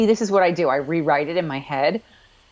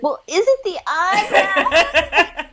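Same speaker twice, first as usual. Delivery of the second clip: laughing mock question; 13 semitones higher, 4 dB louder, slower than usual. Mock quizzing the show, laughing.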